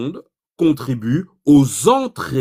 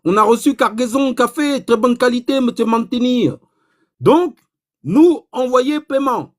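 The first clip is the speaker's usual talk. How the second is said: higher, broken French in a mock Chinese accent; put-on Chinese accent; 5 semitones higher than usual. Chinese buyer reporting the coffee delivery, in a mock Chinese accent. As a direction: play the Chinese caller in a broad put-on Chinese accent. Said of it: "l'accent chinois que je fais".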